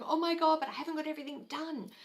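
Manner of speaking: high, loud and rushed panic; mock panic over unfinished work; panicked freak-out. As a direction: gasp it out in a frantic flap. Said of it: "freaking out and going".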